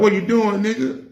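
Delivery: high, loud happy call to a friend; big hype hello to an old homie; excited greeting. Retold or imitated. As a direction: call out to him with delight. Greeting his little brother warmly.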